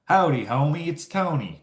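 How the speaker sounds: high-pitched mock Southern greeting; Southern accent. Sign seller on Instagram Reels, imitated in a put-on Southern accent. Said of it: "Puts on, like, this somewhat Southern accent".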